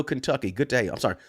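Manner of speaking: ordinary delivery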